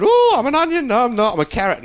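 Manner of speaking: high, loud, manic vegetable voice; mad onion. Pretend. Mad onion raving about what it is, in a manic high voice. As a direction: play a crazed onion raving. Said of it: "these onions that are mad going".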